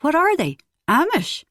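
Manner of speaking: can't believe what he's hearing; astounded. Acted. Barty hearing the girl has no phone, incredulous. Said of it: "Barty was astounded".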